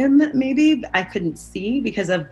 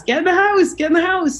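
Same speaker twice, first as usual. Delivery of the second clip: high, loud, urgent mock yell; retold screaming; 10 semitones higher, 4 dB louder, faster than usual. Mother sending her kids indoors, retold as high screaming. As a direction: imitate a parent yelling orders, pitched way up. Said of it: "then screaming at us".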